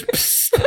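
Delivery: short unvoiced hiss; hissing sound. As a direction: hiss like a spitting dinosaur. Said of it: "what's that dinosaur that goes".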